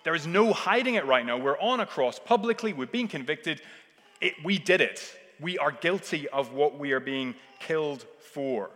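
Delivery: loud, high urgent confession; a criminal owning up, loud and pleading; honest criminal confessing. Acted. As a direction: cry out the guilt from the cross. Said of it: "He's going".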